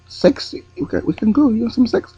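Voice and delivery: high, soft persuasive offer in a put-on accent; woman tempting him again, soft mock European accent. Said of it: "try and do an accent".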